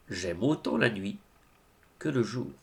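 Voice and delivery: low, soft echo of the canticle; subdued refrain. Acted. Closing the saint's canticle of love, subdued and soft. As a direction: bring it down gently, pausing midway.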